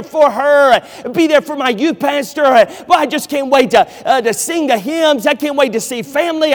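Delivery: hammed-up student excitement; eager student. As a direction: play an overexcited college student. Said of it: "the college student that says".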